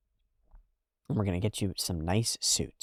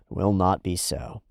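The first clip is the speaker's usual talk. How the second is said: ordinary delivery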